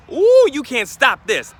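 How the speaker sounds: slow, loud, high hype-up; pumped-up boast. Retold or imitated. A job candidate hyping himself up, slow loud boast. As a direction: stretch it out like nothing can stop you. Confident self pumping up before interview.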